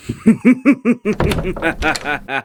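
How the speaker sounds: long high triumphant villain laugh; evil laugh. Acted. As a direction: close the scheme with a triumphant cackle. Villain ends scheming scene.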